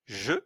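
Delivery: « je » avec un e neutre et court; son e muet. Shown as a demonstration. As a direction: dites « je » sans appuyer sur le e. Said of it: "c'est le symbole qui se prononce E". (le professeur montre le son « e »)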